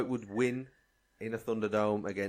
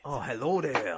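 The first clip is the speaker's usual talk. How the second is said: mock French-sounding accent; badly faked Spanish with a French lilt; failed Spanish, sounding French. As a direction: aim for Spanish and land on French. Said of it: "Oh, that's French".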